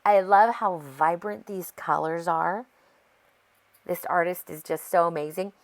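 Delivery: ordinary talk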